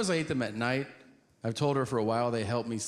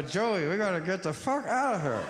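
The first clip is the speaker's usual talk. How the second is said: urgent, higher, half-laughing plea to flee; panicked urgency; 5 semitones higher than usual. Retold or imitated. Comic telling Joey to flee, panicked. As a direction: get us out of here now.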